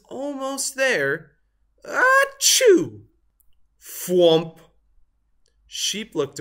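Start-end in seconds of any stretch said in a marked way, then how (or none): 1.8-3.1 high, loud hammed-up sneeze; a sudden explosive sneeze. A narrator's reading. Turkey sneezes. 3.8-4.6 loud thwomp of a fall; a heavy landing sound; thud effect. A narrator's reading. Turkey falls.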